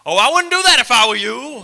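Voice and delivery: very loud, high warning holler; over-the-top shouted warning; horror-movie warning guy. Acted. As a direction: holler it out like a doom-saying local.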